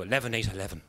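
cheesy radio jingle voice. Retold or imitated. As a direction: croon the number like a cheesy radio ad.

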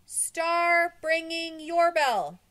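slow, high, drawn-out holler; crowd shouting. A narrator's reading. Crowd below shouts over the bell, slow loud holler. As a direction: shout each word up to the roof.

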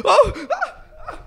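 high laughing gasp of mock awe. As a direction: gasp and laugh in fake amazement. Mock awe reaction, laugh heard. (jadeo de asombro fingido entre risas)

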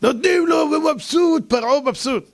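a king delighted with his gifts; gleeful. Acted. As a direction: crow about the gifts. Preacher acts Pharaoh pleased.

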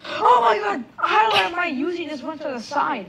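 high, loud frustrated outcry; frustrated shout. Real, not acted. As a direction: yell it at the screen. Frustrated at game controls.

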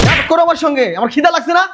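indignant outburst. Acted. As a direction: demand food with rising indignation. Hungry man quarrels with sister-in-law.

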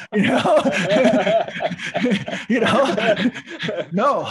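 laughing loudly through the words. Real, not acted. Storyteller laughing at his old denial, loud. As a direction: crack up at your own denial.

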